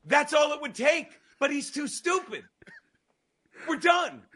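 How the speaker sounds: fixing the joke, triumphant. Real, not acted. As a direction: crow that the joke is fixed.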